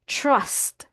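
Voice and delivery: "trust" as "chrust", falling; "trust" starting with a rounded chr; correct TR sound. Shown as a demonstration. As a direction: say "trust" with the American CH-like TR. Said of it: "Ito ay".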